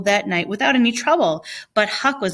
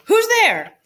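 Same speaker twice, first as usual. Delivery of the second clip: high loud call through the window; 13 semitones higher, 6 dB louder than usual. Acted. Welshman calling from his window at dawn, loud and high. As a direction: call out who's there.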